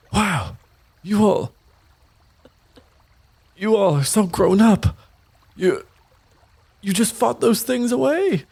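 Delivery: high, wide-eyed amazed father; Estelar amazed. Acted. Proud father marvels at his children's fight, high amazed voice. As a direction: gush in awe at grown kids. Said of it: "Estelar's like".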